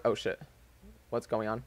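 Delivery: real distracted mutter, low and soft; muttered aside. Real, not acted. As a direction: drop to a quiet, low self-directed aside. Phone screen shuts off mid-story.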